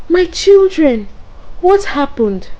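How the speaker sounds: high, loud frantic mother's cry. Acted. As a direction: call out in panic to her children. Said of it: "screaming their names".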